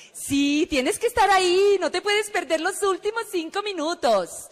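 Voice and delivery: high, coaxing recruiter voice; insistent caller. Retold or imitated. Recruiter urges Martica to attend, high and insistent. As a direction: plead brightly, imitating a pushy caller.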